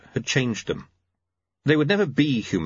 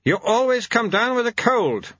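high-pitched, firm wifely scolding; 12 semitones higher than usual. A narrator's reading. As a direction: remind your husband firmly in a high voice. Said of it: "she said firmly".

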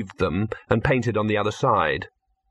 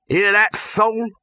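calling out; 11 semitones higher, 4 dB louder, slower than usual. A narrator's reading. Producer calls out to nephew.

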